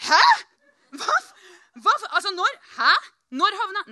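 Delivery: high, loud stammering disbelief; baffled shock. Retold or imitated. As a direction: sputter broken questions in mock shock. Acted reaction to an unexpected kiss. (høy, stotrende forbløffelse)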